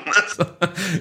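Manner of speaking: quiet giggle at own joke; brief soft chuckle; soft laugh. Real, not acted. Laughs at own silly effect.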